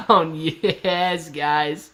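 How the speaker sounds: ordinary delivery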